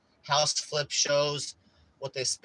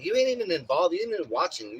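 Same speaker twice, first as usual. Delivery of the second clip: higher, louder indignant jab; indignant retort; 6 semitones higher, 5 dB louder than usual. Retold or imitated. Scolding stepdad over the show, indignant and raised. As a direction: call him out for not even watching. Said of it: "I'm like".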